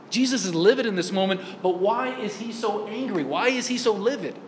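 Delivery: normal talking voice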